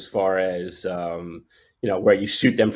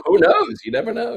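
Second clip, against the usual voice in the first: who knows, you never know, lifted much higher and sing-song; playful wonder; 14 semitones higher than usual. Real, not acted. Guest muses to host about fate, playful high sing-song. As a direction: tease the mystery with a light, high lilt. Musing on fate of son's birth.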